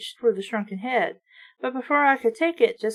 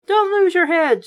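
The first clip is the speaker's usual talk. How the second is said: mock pun; 7 semitones higher, 6 dB louder than usual. Real, not acted. Punning joke line.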